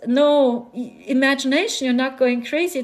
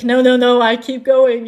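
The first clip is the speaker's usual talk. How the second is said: emphatic refusal; 6 dB louder than usual. Real, not acted. Refusing inner voice, loudly.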